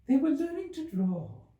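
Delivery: high, drowsy little Dormouse; sleepy Dormouse. Acted. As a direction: drift toward sleep in a high small voice. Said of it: "yawning and rubbing its eyes, very sleepy".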